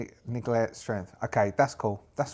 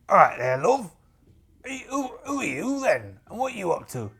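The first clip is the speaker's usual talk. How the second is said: hammed-up pirate, pitched up and forceful; rough-spoken pirate captain; 7 semitones higher, 4 dB louder than usual. Pirate hailing a stranger in the clearing, hearty role voice.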